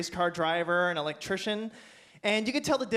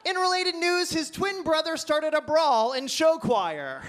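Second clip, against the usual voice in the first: much higher, slow anchor read through laughs; mock newscaster; 7 semitones higher, 4 dB louder than usual. Local news reporting the brawl, mock anchor read. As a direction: keep the news-anchor voice going. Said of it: "local news".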